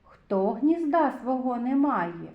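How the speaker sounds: ordinary delivery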